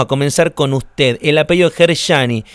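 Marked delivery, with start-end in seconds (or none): 1.7-2.4 "Gershani" with a hushing sh after the r; pronunciación con SH. Shown as a demonstration. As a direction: say "Gershani" with sh in the middle. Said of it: "cómo es la pronunciación correcta". ("Gershani" pronunciado con sonido sh)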